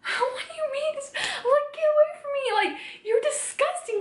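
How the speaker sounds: very high, tearful voice breaking with sobs; crying out. Real, not acted. Recalling the assault to her viewers, breaking into tears. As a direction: cry the words out, overwhelmed.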